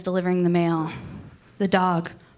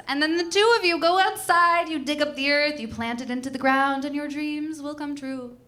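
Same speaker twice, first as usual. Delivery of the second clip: mock mystical instructions; 6 semitones higher than usual. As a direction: sell it like a dreamy wellness guru. Mock manifesting ritual instructions.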